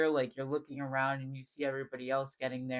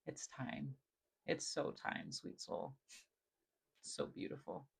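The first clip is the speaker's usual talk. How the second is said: hushed tender whisper; 9 dB quieter than usual. Real, not acted. Psychic reader whispers tenderly to viewer, sweet soul. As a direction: breathe it to the viewer like a secret blessing.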